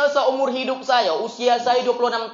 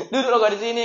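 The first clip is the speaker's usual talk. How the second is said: laughing quote; much faster than usual. Retold or imitated.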